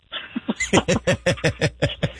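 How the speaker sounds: a short amused laugh at the memory; real laugh after the punchline. Real, not acted. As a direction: laugh along at the joke. Laughs recalling the notary joke.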